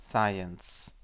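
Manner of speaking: ordinary delivery, nothing marked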